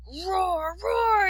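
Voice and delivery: put-on very high, rising little-boy roar; child's dragon roar. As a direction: roar bravely at the horse. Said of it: "he cried".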